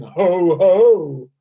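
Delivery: loud round ho, ho; ho laugh sounds. Shown as a demonstration. Laughter teacher with the class, loud ho, ho. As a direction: boom out ho from the belly. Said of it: "So let's go ho".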